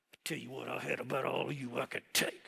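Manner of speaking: low, soft fed-up mutter; quiet disgust. Retold or imitated. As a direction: drop it to a grim undertone. Moses drops to muttered disgust.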